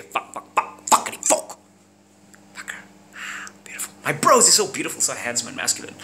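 usual speaking voice

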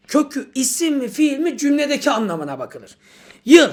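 normal talking voice

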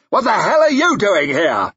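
high, loud furious snarl. A narrator's reading. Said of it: "he snarled".

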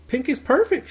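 high, loud "Pink is perfect"; happy high-pitched claim; bright proud declaration. A narrator's reading. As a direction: announce it with delight. New girl declares proudly.

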